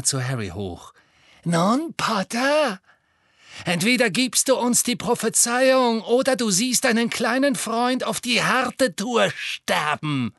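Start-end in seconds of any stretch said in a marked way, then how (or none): 1.4-10.3 high, taunting threat; wicked, high-pitched menace; cruel villainess. A narrator's reading. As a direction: give an ultimatum with relish, stretching the final word. Bellatrix threatens Harry.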